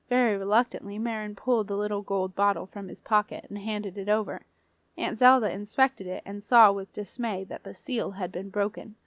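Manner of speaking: plain reading voice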